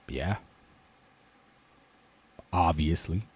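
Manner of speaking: low, quiet, understated agreement; lightly voiced lion judge. Lion answers the tiger's argument, low and understated.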